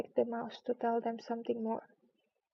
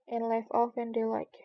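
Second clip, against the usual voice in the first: ordinary delivery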